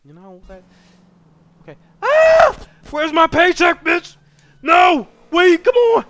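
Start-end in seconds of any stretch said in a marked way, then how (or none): 2.0-6.0 high, screaming protest; hammed-up wailing outrage; mock tantrum. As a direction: scream like you've been robbed of your pay. Mock tantrum demanding paycheck.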